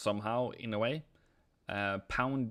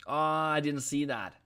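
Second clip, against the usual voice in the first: ordinary talk, nothing marked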